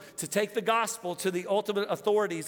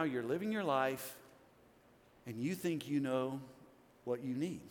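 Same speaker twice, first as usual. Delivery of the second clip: normal talking voice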